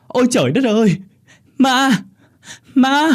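loud, very high scream of horror; terrified shriek. A narrator's reading. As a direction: scream out at seeing a ghost. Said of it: "Bà rú lên". (rú lên kinh hãi)

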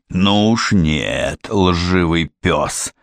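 panting, slow breathless words; out of breath. A narrator's reading. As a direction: gasp it out slowly. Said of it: "задыхаясь".

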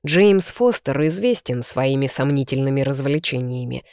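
usual speaking voice

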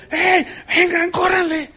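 agudo, llamando a los demás; grito imitado. Retold or imitated. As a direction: imita al pastor que grita para llamar a todos. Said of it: "uno de ellos gritó". (un pastor llama a gritos a los demás, grito imitado)

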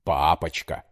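Папочка mimicked in father's low voice; mock her Папочка. A narrator's reading. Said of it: "передразнил он её".

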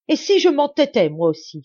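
father shouts at his daughter in defiance; angry outcry. A narrator's reading. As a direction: cry it out in stubborn defiance. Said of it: "s'écria-t-il".

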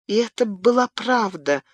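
plain reading voice

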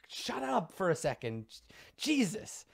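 high-pitched scolding, not shouted; exasperated mother. Acted. Woman covers the phone to scold kids, high exasperation. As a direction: hush rowdy kids away from the receiver. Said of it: "covers the phone".

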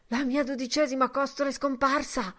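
high, loud startled cry; alarmed cry. A narrator's reading. Mulligan breaks off feeling his side, crying out in alarm. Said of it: "gridò".

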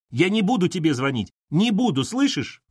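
high, insistent acted shout. A narrator's reading. Katerina refuses to phone her boss, shouting. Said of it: "закричала Катерина".

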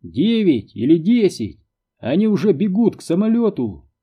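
high-pitched, apologetic report. A narrator's reading. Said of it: "таким тоном, словно извинялся". (извиняющимся тоном)

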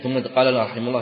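ordinary talk, nothing marked

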